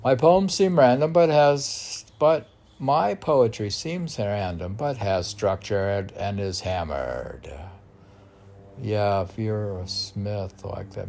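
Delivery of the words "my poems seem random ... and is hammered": loud recitation. Acted. Poet re-declaiming his poem to the host, loud. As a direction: declaim it with force.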